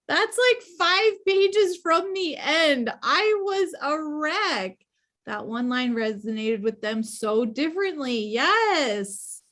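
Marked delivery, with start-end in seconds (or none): 0.1-4.8 high, loud, drawn-out wail of confession; emotional exclamation. Real, not acted. Reader telling book club the ending wrecked her, overwrought. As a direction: exclaim it high, stretching the words.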